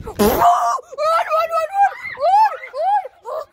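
high frantic yelling; panicked shouting. Real, not acted. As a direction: scream run in panic. Kids fleeing in hide and seek.

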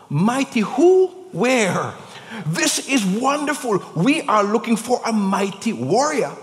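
playing Gideon hunting for the warrior; baffled, looking around.

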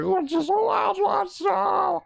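very high, drawn-out falsetto battle cry; mock yelling. Gamer quoting an episode's fighter, high mock yell. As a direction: imitate a warrior's challenge, stretching every word slowly. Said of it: "Like, yelling".

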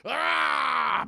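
loud, high mock roar of effort; monster roar. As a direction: roar it out as the giant ape. Kong crushing two helicopters.

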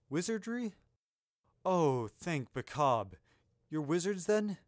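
startled question turning to heartfelt relief; profoundly relieved. A narrator's reading. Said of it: "feeling profoundly relieved".

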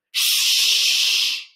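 long, loud shushing; horse's shush. Acted. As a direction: horse shushing the noisy snake. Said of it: "He turns to the snake and he says".

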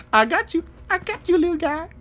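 very high cutesy voice; playful baby talk. Pretend. Player catching a little rat, high cutesy teasing. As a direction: tease a tiny pet in a high coo.

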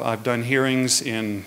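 ordinary talk

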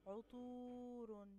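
slow loud demo of عطور; loud drawn-out model word. Shown as a demonstration. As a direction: call out عطور slowly to the class. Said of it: "عطور الحرف الممدود فيها الطاء".

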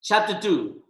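ordinary talk

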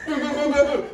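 imitated banging on the stall door; pounding door knocks. Knocking again.

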